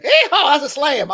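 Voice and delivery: hyped monster truck announcer. Retold or imitated. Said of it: "those, like, monster truck announcers".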